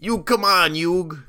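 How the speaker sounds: high, urgent cartoon Brooklyn plea; Joey's Brooklyn accent. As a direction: egg on a pal in mock Brooklyn. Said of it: "He usually just called him Yug".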